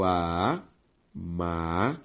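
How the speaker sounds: "amável" drawn out as a... má... vel; syllable by syllable. Shown as a demonstration. Teacher showing students the division, syllable by syllable. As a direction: split "amável" into three slow, separate syllables. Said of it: "ao separar amável".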